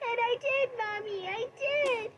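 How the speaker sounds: put-on high, tearful child's wail, pleading; crying little child. Little boy crying to his mommy at the wedding, high sobbing plea. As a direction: sob and beg like a small kid. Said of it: "a freaking child who was crying".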